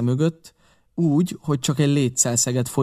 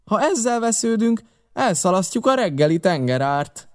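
raised, high-pitched protest; Long John Silver; 8 semitones higher than usual. A narrator's reading. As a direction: cry out high, easing down towards the end. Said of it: "kiáltotta hosszú John". (magas hangú, méltatlankodó kiáltás)

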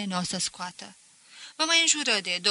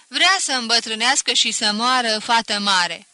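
loud, jeering friend, much louder; booming mockery, pitched up on warnings; loud teasing; 7 dB louder than usual. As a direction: rib him loudly like a know-it-all pal. Friend teasing narrator about girl.

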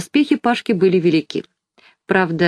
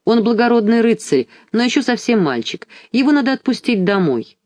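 put-on higher, earnest young girl asking for the boy; girl's pleading voice; 6 semitones higher than usual. A narrator's reading. Alice speaks up to the king for Pashka, higher, pleading. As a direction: plead for him like a brave girl. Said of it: "вмешалась в спор Алиса".

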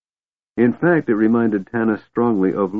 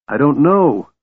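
higher, louder desperate cry; 7 semitones higher, 5 dB louder than usual. A narrator's reading. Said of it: "Beram cried".

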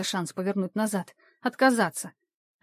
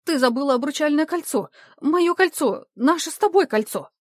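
rising, louder, higher pleading cries; desperate wail; 6 semitones higher, 5 dB louder than usual. A narrator's reading. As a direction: wail it louder each time. Jilted fiancé cries out.

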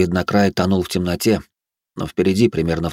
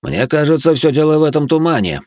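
higher, emphatic assertion; earnest, raised-pitch certainty; with conviction; 8 semitones higher, 4 dB louder than usual. A narrator's reading. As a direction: state it like you're convinced. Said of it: "убеждённо произнёс Эд".

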